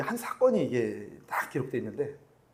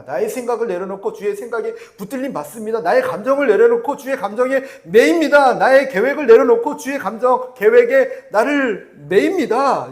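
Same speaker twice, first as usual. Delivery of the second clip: higher, louder, building surrender declaration; preaching at full force; 7 semitones higher, 13 dB louder than usual. Acted. Preacher declaring surrender to the congregation, rising fervent preaching.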